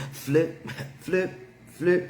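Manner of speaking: high rhythmic "flip, flip" mimicking the slap; playful sound effect with a laugh; flip flop sound. Real, not acted. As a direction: imitate the sandal slapping. Imitating flip flop noise.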